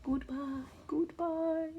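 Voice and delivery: very soft, distant calling; faint goodbye. A narrator's reading. Farewell to the giraffe, soft and far off. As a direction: fade the farewell away.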